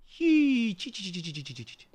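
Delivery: repeated hiç, dying away to nothing; a playful refusal trailing into silence; fading to silence. Pretend. Teasing, won't say a word.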